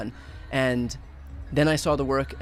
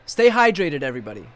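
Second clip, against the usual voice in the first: calling out; 6 dB louder than usual. Real, not acted. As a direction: shout the warning out. Warning fainting crowd.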